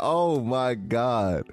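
drawn-out, stunned; slow stunned oh my God. Retold or imitated. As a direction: stretch it out in disbelief. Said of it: "I said".

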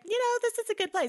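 high, quick imitated chatter; mock builders' voice. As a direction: rattle it off high, as a mocking quote. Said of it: "And they're like".

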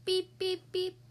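playful beep beep horn; truck horn beeping. Pretend. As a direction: toot it like a toy truck. Truck honks in story.

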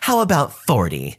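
put-on voice of a pushy entitled mom; entitled Karen. Narrator voicing the Karen haggling for the Game Boy, put-on pushy voice. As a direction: play the pushy mother lowballing a kid.

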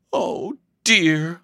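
high-pitched sigh of dismay. A narrator's reading. Daughter dismayed by the unexpected guest, sighing. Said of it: "sighed Elsie".

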